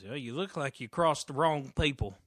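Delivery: loud wary street voice; loud wary stranger; scraggly stranger. Acted. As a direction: warn him off, wary.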